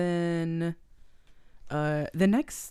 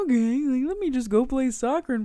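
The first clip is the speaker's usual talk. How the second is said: sarcastic mock; 7 semitones higher, 6 dB louder than usual. Mock compliant Channing.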